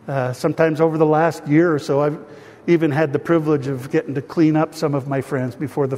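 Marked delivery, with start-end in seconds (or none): none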